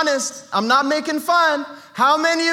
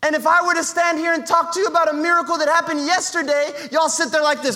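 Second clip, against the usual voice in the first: ordinary delivery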